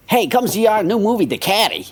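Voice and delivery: high-pitched nerdy comic promo voice; Jerry Lewis nerdy voice. Retold or imitated. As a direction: pitch it up like a goofy nerd comedian. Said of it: "he's doing his Jerry Lewis nerdy thing".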